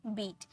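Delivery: बीट with one long ee vowel; बीट, the ea read as a pure ee. Shown as a demonstration. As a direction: say बीट as a single syllable, "beet". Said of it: "ई में पढ़ते हैं".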